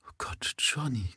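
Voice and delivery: breathless whispered wail; whispered lament. A narrator's reading. Mother lamenting to her son in a whisper. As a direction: whisper it in dismay. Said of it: "klagte sie".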